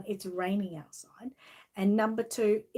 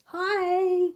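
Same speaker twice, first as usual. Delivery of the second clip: bright high hello; 11 semitones higher, 6 dB louder than usual. Real, not acted. Greeting a viewer.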